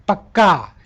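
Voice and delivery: पक्का hit firmly on the doubled k; stress on पक्का. Shown as a demonstration. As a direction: punch out पक्का as a stress demo, falling at the end. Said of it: "यानी पे जोड़ पड़ रहा है".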